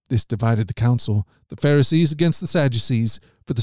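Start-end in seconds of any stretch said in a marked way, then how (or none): none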